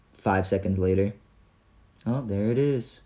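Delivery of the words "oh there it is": imitating a bored monotone, softly. Clerk finding it five seconds later, very monotone. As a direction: say it softly with no lift at all. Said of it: "Like, very monotone".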